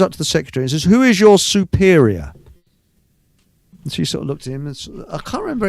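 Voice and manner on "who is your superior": stern policeman. Retold or imitated. Police officer demanding, retold.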